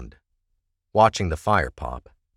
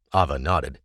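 plain reading voice

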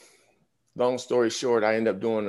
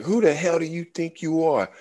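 higher, heated scolding; angry chewing out; 8 semitones higher than usual. Retold or imitated. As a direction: lay into the rookie like furious veterans. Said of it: "they're going in on me like".

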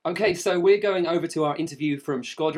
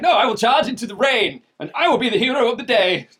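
grand, raised heroic voice; heroic declaration; 8 semitones higher, 8 dB louder than usual. Retold or imitated. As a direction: proclaim it like a storybook hero. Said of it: "was like".

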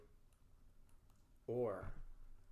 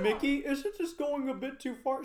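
Goofy voice; 8 semitones higher, 5 dB louder than usual. Pretend. Acted Goofy voice.

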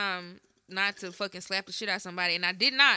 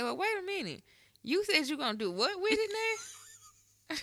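high, softer voice of puzzlement; confused mother; 8 semitones higher, 5 dB quieter than usual. Retold or imitated. Mama hearing about trash and the balcony, confused. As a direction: stop them short, baffled, as an imitated mom. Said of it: "Because she was confused".

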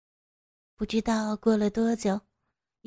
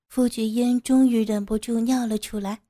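ordinary delivery, nothing marked

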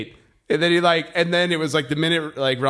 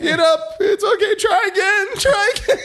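put-on high, loud, sobbing kid voice; crying toddler; 5 dB louder than usual. Son soaked and crying after falling, high sobbing. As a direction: wail it like a soaked crying child.

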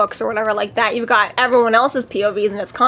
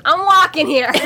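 put-on Boston accent; 5 semitones higher than usual. Friend joking Harry should be in Boston, Boston accent. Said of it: "thrown him in Boston or something".

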